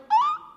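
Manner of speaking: very high, short mock "ah"; retold pain cry. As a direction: squeal it like a sudden injury. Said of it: "and I was like".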